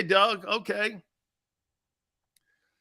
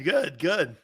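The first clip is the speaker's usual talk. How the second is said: normal talking voice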